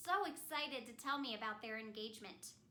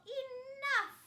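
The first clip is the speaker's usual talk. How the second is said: loud high shout; yell; 12 semitones higher, 8 dB louder than usual. Acted. Elsa shouting at her sister, loud yell. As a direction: shout it to stop them. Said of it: "and I yell".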